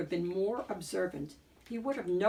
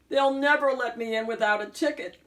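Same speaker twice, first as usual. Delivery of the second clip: despairing cry; 4 semitones higher, 6 dB louder, faster than usual. A narrator's reading. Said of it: "he cried in despair".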